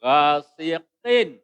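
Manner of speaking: slow, loud "ghasiqin" with a distinct final n; clear izhar ending. Shown as a demonstration. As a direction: draw out "ghasiqin" and sound its n cleanly. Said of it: "sama dengan tadi".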